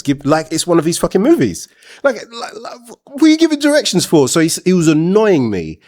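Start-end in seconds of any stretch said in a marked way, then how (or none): 2.0-4.3 high exasperated disbelief; incredulous, pitched right up; fed-up rhetorical question, voice climbing high. Real, not acted. Annoyed at guy giving instructions.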